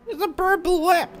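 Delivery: very high, loud commentator squeal; over-the-top high-pitched race call; mock excited shout.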